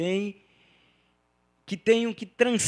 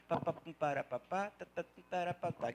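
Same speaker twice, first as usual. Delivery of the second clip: quietly tapping out a beat in syllables; soft spoken drumbeat; soft rhythm syllables; 12 dB quieter than usual. Shown as a demonstration. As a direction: murmur the rhythm like a drum. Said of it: "ele tem um ritmo dentro dele".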